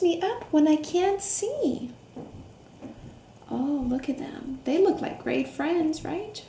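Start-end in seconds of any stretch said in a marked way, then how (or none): none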